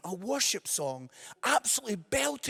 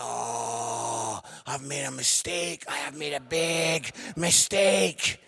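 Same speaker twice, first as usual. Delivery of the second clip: mock despair; 7 semitones lower, slower than usual. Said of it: "And I'm like".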